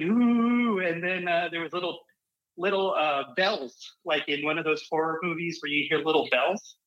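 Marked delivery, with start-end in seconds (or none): 0.0-0.8 high ghostly ooh; one spooky drawn-out moan. Retold or imitated. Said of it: "it was really".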